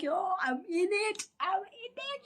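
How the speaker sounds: high strained pleading; urgent plea. Retold or imitated. Telling the annoyed nurse she is in labor, high and strained. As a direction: squeak it out, mid-labor. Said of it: "I was like".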